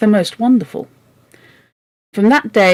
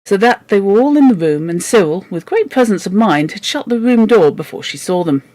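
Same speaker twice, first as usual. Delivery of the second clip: usual speaking voice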